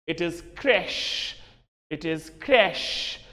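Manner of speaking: CRECHE said slowly as "kresh"; slow, deliberate CRECHE, ending in sh; correct pronunciation. Shown as a demonstration. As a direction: model CRECHE with a long sh and no n.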